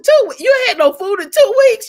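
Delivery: stunned, high-pitched disbelief; astonished. Retold or imitated. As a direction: blurt it out in shock. Teen self astonished.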